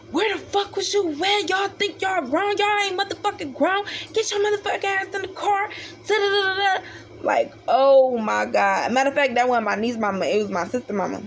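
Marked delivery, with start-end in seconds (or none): 0.1-6.8 high-pitched imitation of a furious mother; angry mom yelling. Sister's mama scolding the girls for disappearing, mock angry. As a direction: mimic a mom chewing the kids out, pitched up. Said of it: "all I hear, my niece mama".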